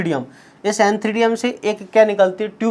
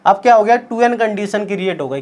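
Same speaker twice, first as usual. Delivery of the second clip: ordinary talk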